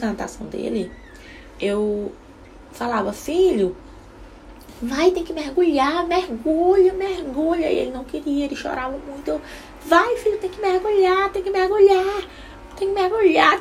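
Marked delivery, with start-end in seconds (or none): 3.1-7.7 anxious mom urging her son to dive; urgent pushing. Retold or imitated. 9.8-13.6 much higher, louder repeated command; escalating, desperate push; frantic mother at swimming lesson repeating orders; frantic urging. Retold or imitated. Pressing crying son harder.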